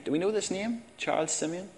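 lifted quizzical "Charles Simeon?"; questioning congregation. Real, not acted. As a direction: poll the room.

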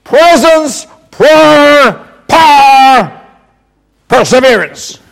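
punchy list in mock Irish, mostly high; imitated Irish accent. Shown as a demonstration. Preacher modelling the four words for the congregation, mock Irish. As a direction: call each word out separately with an Irish twang. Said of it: "So this is like this".

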